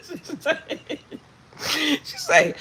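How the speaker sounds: high giggling stammer; laughing. Real, not acted. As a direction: try to talk through the giggles. Laughing fit.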